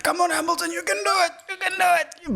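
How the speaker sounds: high, excited cheering; root for him at the screen. Retold or imitated. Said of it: "shouting at the T V".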